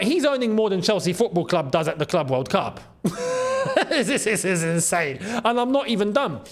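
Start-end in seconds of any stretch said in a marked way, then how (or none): 3.0-3.8 high burst of disbelieving laughter. Real, not acted. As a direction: laugh in shock at the numbers.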